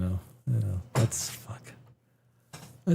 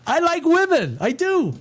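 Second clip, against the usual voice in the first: much higher, insistent mock protest; emphatic high insistence; 12 semitones higher than usual. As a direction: squeak it out defensively like he's overselling it. Repeats line with high emphatic voice.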